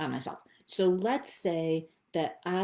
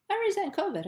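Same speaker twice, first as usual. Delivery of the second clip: much higher, real emotion breaking through; finally admitting a real feeling, pitched up; blurted confession; 3 dB louder, faster than usual. Real, not acted. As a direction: blurt the resentment out. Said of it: "I feel emotion coming up".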